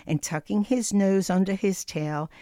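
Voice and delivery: ordinary delivery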